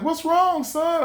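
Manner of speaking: high, lightly voiced worried mother; mom's concern. His mom asking her son what's wrong, lightly voiced. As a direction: ask it like a caring mom. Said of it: "she's like".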